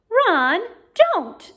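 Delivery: sharp, loud high rebuke; angry rebuke. Acted. Hermione scolds Ron, sharp and angry. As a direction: stop him with a sharp cry. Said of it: "said Hermione angrily".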